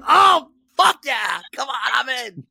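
hammed-up whoop of agreement, pitched high; excited acceptance. Coach jumping at the player's offer, hammed-up excitement.

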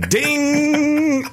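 a very high held ringing ding; imitated pole ding. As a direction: sing out the pole's ring high and steady. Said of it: "And the pole goes".

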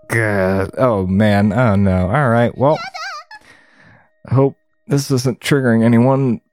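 normal talking voice

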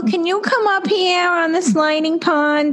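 put-on high little-kid New York accent; New York toddler. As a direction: invite a playmate, New York child style. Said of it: "'Cause I had such a New York accent".

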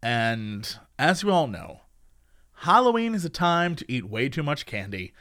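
low, slow spooky storyteller; spooky announcer. Acted. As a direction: creep it up like a campfire tale. Spooky ad read.

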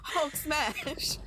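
giggling through the words; laughing. Pretend. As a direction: laugh while saying it. Jokingly telling bear to Hulk.

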